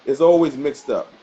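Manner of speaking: ordinary delivery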